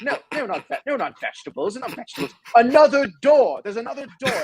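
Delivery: high-pitched, hammed-up Australian minister; a poor mock Australian accent; bad Australian accent. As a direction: put on a terrible Australian accent, correcting the kid. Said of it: "my Australian accent is terrible".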